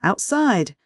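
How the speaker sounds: mildly cross one-word command; snapped. A narrator's reading. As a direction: dismiss the cheeky boy sharply. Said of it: "snapped Miss Butcher".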